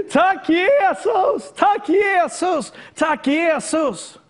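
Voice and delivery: high, slow cries of thanks, fading a little; retold joyful shout. A healed man cries out his thanks, in an ecstatic high shout. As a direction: shout thanks to Jesus, drawn out high and loud. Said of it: "ropar han ut".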